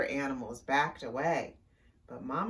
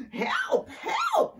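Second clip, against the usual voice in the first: very high, loud kitty squeal; meowed cry; 7 dB louder than usual. Acted. Cat fleeing the burrow, shrieky meowed cry. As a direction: squeal for help like a scared cat. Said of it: "meowed Cat".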